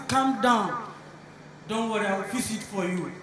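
unruffled, hushed promise; calm reassurance. Acted. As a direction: placate an angry driver quietly. Rich man soothing angry driver.

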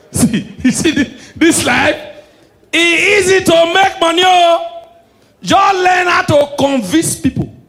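high, loud gloating con man; boastful mock shout. Fake prophet boasts to the audience how easy money is, loud gloating. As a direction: crow it out, pleased with himself.

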